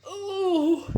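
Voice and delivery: stuffed lizard moaning in discomfort; overfed iguana groans; groaning iguana. Acted. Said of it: "he groaned".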